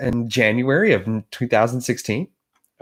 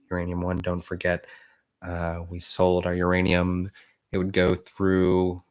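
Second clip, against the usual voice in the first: a lower, steady witness holding his ground; 7 semitones lower than usual. Acted. Witness answering the congressman's question, in a lower steady voice. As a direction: answer it low and unbothered.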